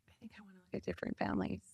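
quick, hushed confession; half-whispered, fast and quiet. Retold or imitated. Telling the agency she wants other families, hushed. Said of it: "I called Kathy and I'm like".

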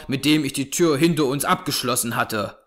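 ordinary delivery, nothing marked

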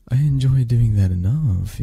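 ordinary delivery

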